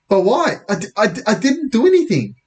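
high, stuttering denial; panicked protest. Acted. Boy protesting innocence to policeman, panicked. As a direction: trip over the words, voice shooting up.

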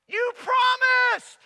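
very high, loud, slow outcry; betrayed shout. Acted. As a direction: scream it at the snake that bit you. Bitten warrior cries out.

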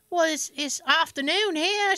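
imitation British phone lady; horrible British accent. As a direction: put on a bad British woman's voice. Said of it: "I do a horrible British accent".

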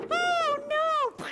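high, loud cry of alarm. Acted. Pirate seeing the mast split, alarmed cry. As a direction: gasp it out in fright.

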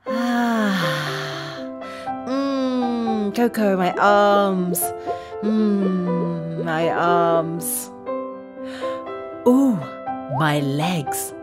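low, soft contented murmur; relaxed sigh. Acted. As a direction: sink into the massage. Coco massages her.

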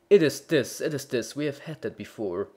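loud mocking echo, then curt; mildly indignant bellman. A narrator's reading. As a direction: scoff at the repetition. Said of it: "the bellman indignantly said".

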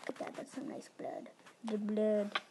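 normal talking voice

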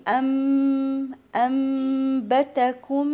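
"أنبتكم" with ن turned into a long hummed m; iqlab. Shown as a demonstration. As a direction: hum "amm" before the ب of "أنبتكم". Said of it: "النون دي هنقلبها الميم".

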